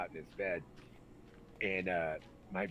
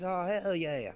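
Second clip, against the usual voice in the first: loud, high Kentucky whoop; imitated Kentucky enthusiasm; thick Kentucky accent; 10 semitones higher, 8 dB louder, slower than usual. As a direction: agree eagerly in a broad Kentucky accent. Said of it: "had the thickest accent".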